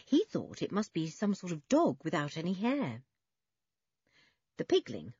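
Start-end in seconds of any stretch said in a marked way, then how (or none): none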